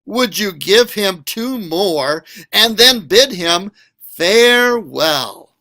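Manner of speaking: usual speaking voice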